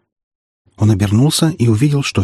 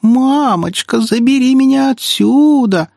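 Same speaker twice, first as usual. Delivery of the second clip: very high, slow mock whining; exaggerated squeaky cry for mummy; squeaky mocking mimicry; 4 dB louder, slower than usual. A narrator's reading. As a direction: jeer like a whining little child. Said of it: "нарочито песклявым голосом передразнила его".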